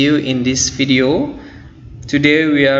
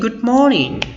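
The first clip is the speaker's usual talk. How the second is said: high, lively answering greeting; spirited good morning; 10 semitones higher than usual. Shown as a demonstration. Teacher playing those who say the same thing back, spirited. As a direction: return the greeting high and full of energy. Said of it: "ada semangat ketika kita berbicara".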